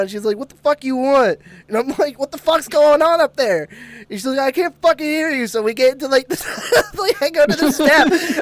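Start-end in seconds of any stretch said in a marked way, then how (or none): 0.4-1.4 mom's raised, higher-pitched shout, imitated; retold yelling back. Mom shouting down to her kid, retold yelling. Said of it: "she's yelling back down". 2.2-3.7 high, loud imitated shout upstairs; retold yell. Kid calling up to mom, retold yelling. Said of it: "So I yell at my mom". 4.4-5.5 mom's high-pitched holler, imitated; retold yelling back. Mom who can't hear her kid, retold yelling. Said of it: "she's yelling back down".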